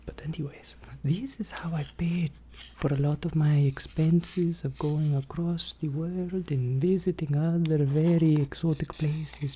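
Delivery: whispered ASMR roleplay voice; fast aggressive asmr. Acted. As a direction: whisper close to the mic.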